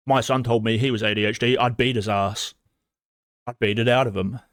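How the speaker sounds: mock threatening parent. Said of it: "Where you're like".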